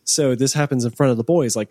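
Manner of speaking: ordinary talk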